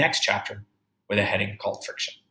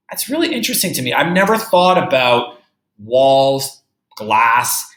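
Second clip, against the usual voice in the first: teacher delighted by the idea; marvelling; 2 semitones higher, 7 dB louder than usual. Real, not acted. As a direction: share your amazement.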